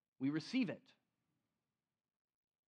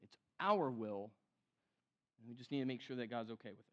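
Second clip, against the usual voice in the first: ordinary delivery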